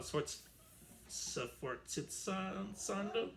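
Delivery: "sforzando" drawn out very slowly and high; unsure term. Real, not acted. Teacher unsure what to call the marking, stretching "sforzando". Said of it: "what should it be called?".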